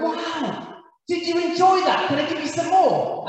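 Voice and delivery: imitating an over-eager cook, high-pitched; eager host. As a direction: gush proudly, pitched well up, as the pleased cook. Proud bad cook urging more food.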